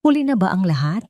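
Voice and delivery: ordinary delivery, nothing marked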